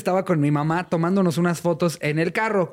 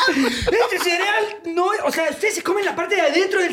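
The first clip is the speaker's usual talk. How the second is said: squeaky wonder at real cereal; hammed-up poor kid tasting cereal; amazed kid; 12 semitones higher than usual. Acted boy about cereal.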